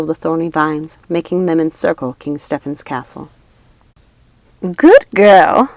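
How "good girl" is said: loud witch cheer; yelled. A narrator's reading. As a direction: yell encouragement. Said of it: "Lucinda yelled".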